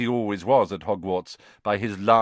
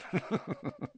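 soft, high, self-satisfied chuckle; little chortle; 12 semitones higher, 10 dB quieter than usual. A narrator's reading. Lockhart amused at Harry's fame, little chortle. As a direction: chuckle lightly to yourself. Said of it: "he gave a little chortle".

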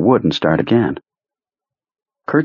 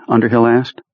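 ordinary delivery, nothing marked

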